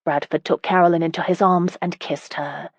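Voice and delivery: ordinary delivery, nothing marked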